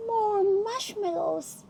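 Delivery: high, slow, eager cry; greedy lion crying out. Acted. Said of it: "cried the lion".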